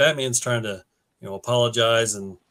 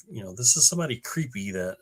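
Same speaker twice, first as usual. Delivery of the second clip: ordinary delivery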